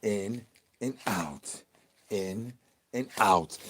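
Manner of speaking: mock calm breathing instruction; slow breathing cue. Shown as a demonstration. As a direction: draw it out like a breathing coach. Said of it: "Say it with me".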